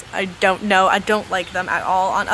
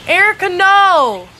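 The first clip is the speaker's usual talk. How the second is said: loud slow call, higher; shouting; 8 semitones higher, 6 dB louder, slower than usual. Real, not acted. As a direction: call her name out sharply. Calling out at someone.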